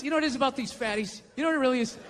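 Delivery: usual speaking voice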